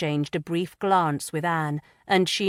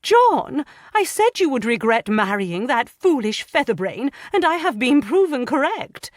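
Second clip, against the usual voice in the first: scolding mother; 8 semitones higher, 6 dB louder than usual. A narrator's reading. Mother scolds her son.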